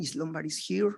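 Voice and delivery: ordinary talk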